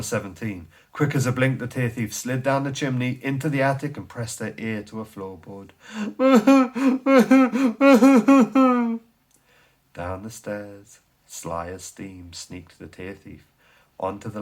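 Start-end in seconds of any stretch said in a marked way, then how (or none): 5.8-9.0 long high loud cackle; a thief's giddy, delighted laugh; gleeful cackle. Acted. The Tear Thief cackling as she slides down the chimney.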